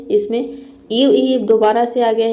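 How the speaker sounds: ordinary talk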